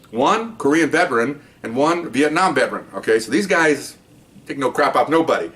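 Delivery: normal talking voice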